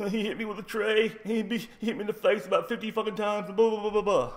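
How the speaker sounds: high, whiny tearful tattling; mock crying. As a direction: imitate a beaten kid blubbering out his complaint. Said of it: "He's fucking crying tears".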